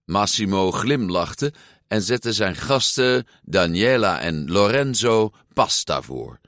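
plain reading voice

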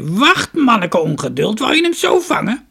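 put-on high, loud, creaky old woman's voice; shrill-pitched storybook crone, full voice. A narrator's reading. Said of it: "de oude vrouw zei".

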